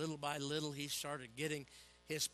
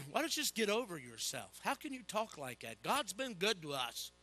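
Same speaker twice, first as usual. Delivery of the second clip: scolding wife; 5 semitones higher, 4 dB louder than usual. Retold or imitated. Wife scolding him, retold.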